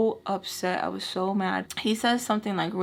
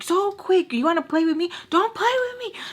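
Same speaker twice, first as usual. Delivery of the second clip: high, loud taunting yell that climbs; 11 semitones higher, 4 dB louder than usual. Retold or imitated. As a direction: shout him down, daring him. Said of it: "So I'm, like, yelling at him".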